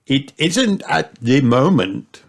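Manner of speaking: slow storybook grandpa; Grandpa Pig. A narrator's reading. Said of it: "said Grandpa Pig".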